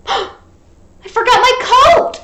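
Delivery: gasp, then high, loud and slow; Froggy realizing his mistake; surprised gasp. Acted. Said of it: "said Froggy".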